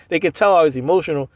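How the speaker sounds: ordinary talk, nothing marked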